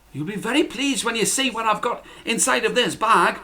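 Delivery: put-on proud, teasing boy; Jack's voice. A narrator's reading. Jack telling his mother about the bag. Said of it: "replied Jack".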